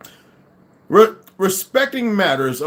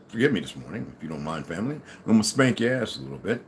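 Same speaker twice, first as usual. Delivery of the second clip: low, much softer sly apology; soft mock apology; 8 semitones lower, 6 dB quieter than usual. Warning his family of followers, low and soft. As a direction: hush it down before the scolding.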